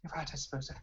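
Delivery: soft, breathy captive elf voice; heavy-breathing elf. Acted. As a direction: give in quietly, breathing heavily. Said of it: "breathing really heavy".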